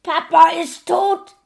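high, loud, harsh shout; mother shouting from the living room. Acted. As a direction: yell it from the next room. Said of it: "rief die Mutter aus dem Wohnzimmer".

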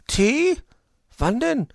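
very high, loud questioning magpie voice; storybook magpie, wearily asking; exhausted magpie. A narrator's reading. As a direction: feign weariness in a high character voice. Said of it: "fragte sie, erschöpft".

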